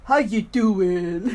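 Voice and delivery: slow, loud sitcom catchphrase impression; Joey from Friends. Teacher jokingly illustrating accents, catchphrase impression. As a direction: drag it out loudly as a comic catchphrase. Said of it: "like Joey in Friends".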